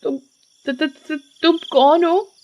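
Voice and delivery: high, stammering plea; choked terror. Acted. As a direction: stammer as if being strangled. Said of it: "गला और जोर से घोटने लगती है".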